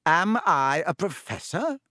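mild surprise. A narrator's reading. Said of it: "in mild surprise".

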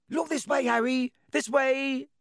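put-on high, eager shout of a starstruck boy; cried shrilly. A narrator's reading. Young fan shouting to Harry across the stadium, high and eager. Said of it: "he cried shrilly".

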